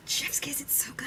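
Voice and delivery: whispered rave; hushed awe. Real, not acted. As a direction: whisper it, swooning over the writing. Gushing over the book, hushed.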